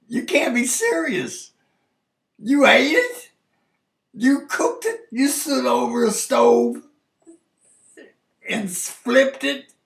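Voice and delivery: as if you can't believe what you're hearing, pitch up; incredulous disbelief. Retold or imitated.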